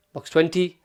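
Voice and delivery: usual speaking voice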